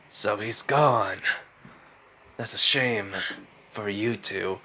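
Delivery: low, slow and soft Gaara line; ominous remark. Acted.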